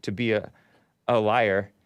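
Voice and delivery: ordinary talk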